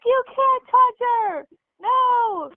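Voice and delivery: high, slow alarmed paramedic; high-pitched mock paramedic drawing out the warning; panicked paramedic. Paramedic stops mom from touching the girl, high and alarmed.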